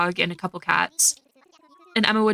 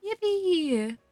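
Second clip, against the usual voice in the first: high, squealed yippee; a little cheer of joy; excited squeal; 9 semitones higher, 4 dB quieter than usual. Real, not acted. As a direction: squeal it with glee. Cheering in game.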